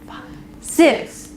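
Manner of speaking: five spoken, six whispered. Shown as a demonstration. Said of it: "whisper every other number".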